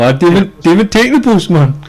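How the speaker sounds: high, lively Geordie catchphrase; Geordie accent. Retold or imitated. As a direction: mimic his Geordie catchphrase. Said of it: "he'd go".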